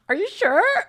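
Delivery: high, loud "are you sure"; incredulous. Retold or imitated. Friends hear the stripper invite, incredulous squeal. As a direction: squeal it in disbelief.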